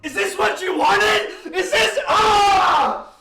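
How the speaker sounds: furious shout at the screen, slowed and drawn out; a real frustrated yell with a laugh breaking through; angry yelling. Real, not acted. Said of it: "I'm yelling, I'm getting angry".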